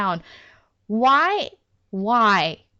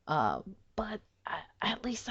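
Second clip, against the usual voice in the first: whisper; 11 dB quieter than usual. Real, not acted. Tired aside about recording sessions.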